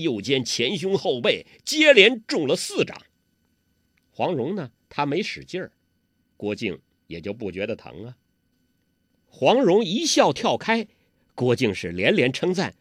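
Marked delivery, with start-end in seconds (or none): none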